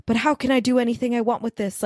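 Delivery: lightly put-on British accent. Student questioning her friend's advice, British accent. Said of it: "just gonna talk in a British accent".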